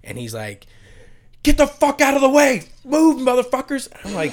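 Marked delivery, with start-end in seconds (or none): 1.4-3.9 high, loud yell to clear a path; imitated crowd-clearing shout. Running wrestler yells at bar crowd to move, urgent high shout. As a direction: bellow it like someone charging through a packed bar.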